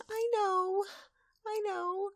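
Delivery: very high, small voice; timid pony. Acted. Timid pony answering Twilight's promise, high and meek. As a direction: squeak it out meekly.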